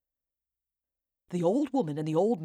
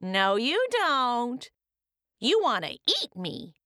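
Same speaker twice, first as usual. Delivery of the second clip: gingerbread man voice; 6 semitones higher than usual. Acted.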